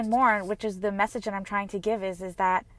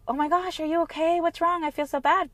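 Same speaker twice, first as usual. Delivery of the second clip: higher, fussing concern; mock worried onlooker; 8 semitones higher than usual. Onlookers fussing over her crying, higher mock concern. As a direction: play a fretting bystander, pitched up. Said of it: "people might not understand and say".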